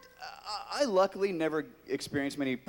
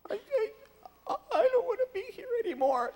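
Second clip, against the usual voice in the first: much higher, fast, whimpering sob; mock crying; much faster than usual. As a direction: whine like a hurt kid. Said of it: "I'm like".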